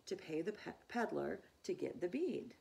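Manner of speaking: ordinary delivery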